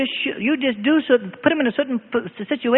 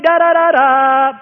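high, loud, drawn-out da-da-da-daaa; Morse V signal; 5 semitones higher, 8 dB louder, slower than usual. Acted. Nation mobilized for war, loud high Morse signal. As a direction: blare the V-for-victory signal, slow and high. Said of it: "That was a World War Two signal".

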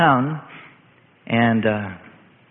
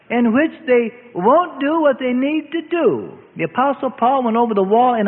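preaching; 7 semitones higher than usual. Acted. Preacher applies the moral.

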